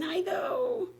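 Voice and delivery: sudden whisper. Real, not acted. Doubting her own declutter plan.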